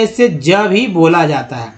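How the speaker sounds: ordinary talk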